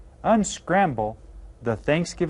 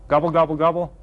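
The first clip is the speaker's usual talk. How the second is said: playful turkey call, louder; turkey gobble; 3 semitones higher, 6 dB louder, faster than usual. Pretend. Teacher imitates a turkey.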